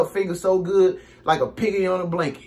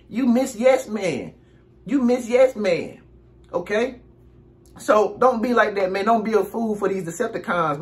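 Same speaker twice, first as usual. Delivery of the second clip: normal talking voice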